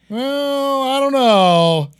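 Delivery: high, drawn-out joke wail; mock wail. Clowning about a supposedly goth stage persona, mock wail. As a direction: stretch it out high and loud, hammed-up.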